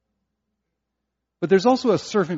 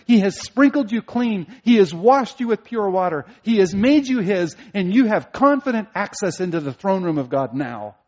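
raised, louder preaching voice; fervent preaching; 6 semitones higher, 7 dB louder than usual. Acted. Preacher urging the congregation toward God, fervently. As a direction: build the assurance with full conviction. Preacher rising to full force.

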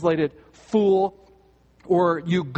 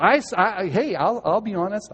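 ordinary delivery

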